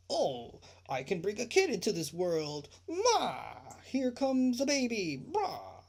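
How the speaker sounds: hammed-up imitation of a clueless new parent; mocking careless parent.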